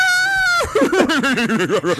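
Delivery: loud high neighing laugh; whinny like a horse, loud. Retold or imitated. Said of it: "the horse whinnying laugh".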